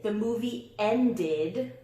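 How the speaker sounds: ended said slowly with a clear id at the end; The movie ended, id sound spelled out; slow id demo. Shown as a demonstration. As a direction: stretch ended so the id is heard. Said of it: "like id in a word like ended".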